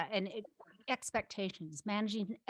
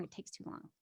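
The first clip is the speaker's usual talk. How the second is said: "it takes too long" whispered, fading away; whispered echo; 15 dB quieter than usual. Retold or imitated. Coach whispering the stakeholders' complaint as it fades. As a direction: let the complaint trail off to a whisper.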